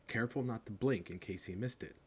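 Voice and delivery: ordinary delivery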